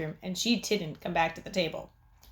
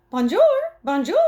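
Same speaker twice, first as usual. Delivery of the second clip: high, louder squawky bird; mynah bird voice; 8 semitones higher, 9 dB louder than usual. A narrator's reading. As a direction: chirp it like a talking bird. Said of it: "Uncle Feather said over and over".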